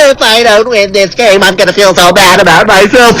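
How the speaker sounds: shrill high wailing fake sobs, shouted; whiny crying gamer. Channel's [name] mocks toxic player, high loud fake crying. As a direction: bawl like a toddler mad at his team. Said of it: "this is what they're gonna hear".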